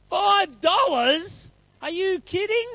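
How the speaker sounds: high, drawn-out mock outrage; acted disbelief. Fred balking at the five bucks offered, acted disbelief. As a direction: squeak it out slowly, incredulous. Fred balks at sum in story.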